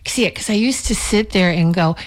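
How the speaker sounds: ordinary delivery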